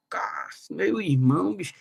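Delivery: hushed, slowed, stunned murmur; breathless awe, almost to himself; in shock. Retold or imitated. Quietly stunned by his top essay grade, slow amazed murmur. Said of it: "assim, em choque".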